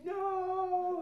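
high, loud sudden "No"; startled realization. Retold or imitated. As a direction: caught off guard, blurt it. Realising the counselor is right.

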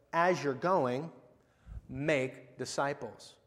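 each word drawn out slowly; slow deliberate reading. Shown as a demonstration. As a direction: lay the phrase down word by word. Stressing the verse's true wording.

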